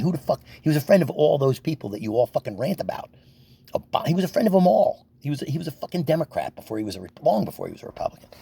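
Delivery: high, loud, fast angry rant about Trump. Real, not acted. Ranting at Trump supporters, angry and high. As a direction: build the anger, voice climbing high.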